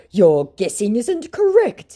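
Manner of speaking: high, slow mock scammer. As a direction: drag it out as the scammer. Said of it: "he said".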